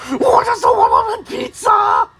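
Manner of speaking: high, tearful cartoon wail; rejected character's shouted tantrum; upset shouting. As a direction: cry out high in wounded outrage. Pizza offer is refused again.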